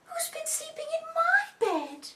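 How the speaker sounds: very high, loud mummy voice; Mummy bear. A narrator's reading. Mummy bear finds her bed messed up, high indignant voice. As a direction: exclaim it high as a mother bear. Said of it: "Mummy goes into her room".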